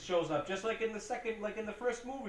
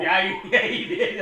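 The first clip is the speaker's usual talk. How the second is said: loud laughing agreement; real burst of laughter through the words; 4 semitones higher, 13 dB louder than usual. Real, not acted. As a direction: crack up while agreeing. Laughs at friend's retold retort.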